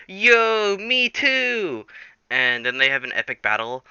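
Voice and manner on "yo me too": high, drawn-out joining-in cheer; mock delighted. Springtrap walks in on the others, mock delighted. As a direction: chime in with exaggerated glee. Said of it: "He's like".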